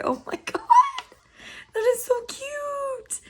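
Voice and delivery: very high soft squeal of delight. Real, not acted. Holding up a Scooby-Doo mirror, squealing softly. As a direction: squeak it out, overcome by cuteness. Gushing over hand mirror.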